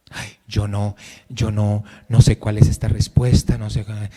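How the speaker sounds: soft, lost mumbling of an unsure believer; confused believer. Retold or imitated. Preacher mocking a confused believer, softly fumbling. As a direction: sound unsure and small, barely audible.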